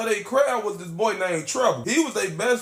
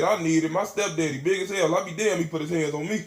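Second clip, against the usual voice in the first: normal talking voice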